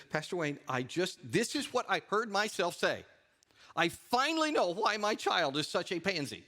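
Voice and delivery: mock distress, building in pitch; upset caller. Upset mother confessing to her pastor, imitated rising distress. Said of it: "she was really upset".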